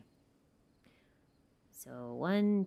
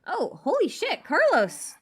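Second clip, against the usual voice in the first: surprised; 10 semitones higher, 5 dB louder, slower than usual. Real, not acted. Surprised by a big super chat.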